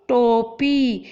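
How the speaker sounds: टोपी with a clear ओ on टो; clear model word. Shown as a demonstration. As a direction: say टोपी showing the ओ. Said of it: "टोपी में किसकी मात्रा".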